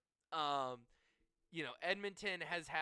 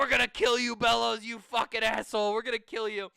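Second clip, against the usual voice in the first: loud, raised-pitch angry threat across a bench; heated hockey chirping, loud and high; jawing taunts; 6 semitones higher, 10 dB louder than usual. Retold or imitated. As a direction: yell trash talk at an opponent. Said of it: "Kevin Stevens is just fucking jawing".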